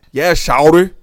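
loud mock Atlanta accent, "Shawty" overdone as SHOWL-der; bad Atlanta accent. Mocking how the movie actor said Shawty, bad Atlanta accent. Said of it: "listen to how old boy say Shawty. It was bad".

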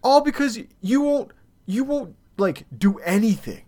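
high-pitched exasperated accusation; retold rant. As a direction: throw the blame at them, pitch way up. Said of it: "I go through this rant".

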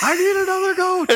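high, thin wizard shout; wizard yelling. Retold or imitated. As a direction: yell it as a frantic wizard. Imitating the wizard, laughing.

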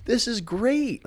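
high, pleased villain voice, jumping way up; a smug vampire faking delight; mock Dracula voice. Dracula voiced mocking werewolf.

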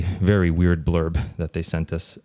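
ordinary delivery

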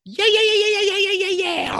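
very high rapid yeah yeah yeah; cartoonish high-pitched chant; manic high yeahs. Jim Carrey bit before Pet Detective.

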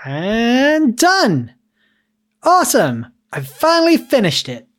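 high, loud triumphant cheer; excited. Acted. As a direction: celebrate finishing the build. Finn excited at finished build.

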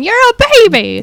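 very high, imitated baby talk; high-pitched Laura voice. Imitating Laura gushing at dogs, high-pitched voice. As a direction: squeal it like fussing over a puppy. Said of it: "doing the high-pitched voice going".

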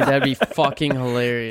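usual speaking voice

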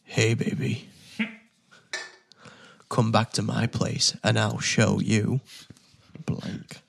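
slow seductive come-on, close to the mic; sexy voice. Pretend. Player reading a game card as a come-on, sexy voice. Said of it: "put a really sexy voice on for this one".

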